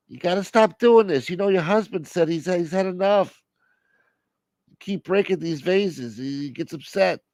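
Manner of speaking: put-on weary phone scolding; exasperated scolding relative. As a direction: reason with a difficult parent.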